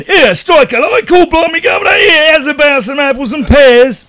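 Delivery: high, loud mock Cockney barrow-boy shout; dodgy Cockney accent. Host introduces co-host in a dodgy Cockney accent. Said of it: "my dodgy Cockney accent".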